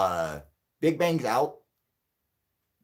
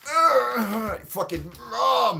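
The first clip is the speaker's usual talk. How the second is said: strained high yell, louder and slow; hammed-up cranky old man shout; mad old man; 12 semitones higher, 6 dB louder, much slower than usual. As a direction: bellow like a furious ancient geezer. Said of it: "mad at everyone".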